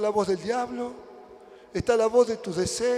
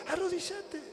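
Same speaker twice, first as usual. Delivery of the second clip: high, soft, gentle order; hushed high voice, acting a patient father; soft insistent command; 8 semitones higher, 6 dB quieter than usual. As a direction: repeat the order quietly in a raised pitch. God's soft command, acted.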